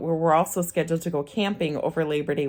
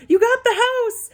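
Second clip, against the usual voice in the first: loud, high-pitched imitation of a scream; excited scream; 7 dB louder than usual. Realtor breaking the news over speakerphone, imitated excited scream. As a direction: shriek the good news, imitating the caller. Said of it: "she just screams".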